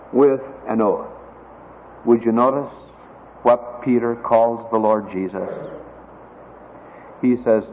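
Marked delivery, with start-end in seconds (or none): none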